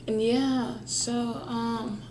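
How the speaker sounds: slow, very soft forced calm; soft mock calm. Reassuring viewers he's calm, soft and slow. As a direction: ease it out soft. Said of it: "Super calm right now".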